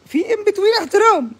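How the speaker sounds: ordinary talk, nothing marked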